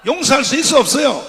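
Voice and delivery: preacher daring the crowd to answer; shouted challenge. Real, not acted. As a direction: fling the question at them.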